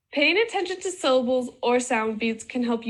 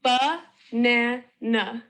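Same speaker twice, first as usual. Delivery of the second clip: "Ba-na-na" broken into three drawn-out beats; sílaba por sílaba; much slower than usual. Shown as a demonstration. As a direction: say "Ba-na-na" slowly, each syllable separate. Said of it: "broken up into syllable sounds".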